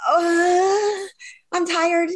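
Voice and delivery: high-pitched mock-crying groan into a whine; whiny weary plea. Tired woman pleading to stay here a moment, mock whine. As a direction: beg for a break, playfully whiny.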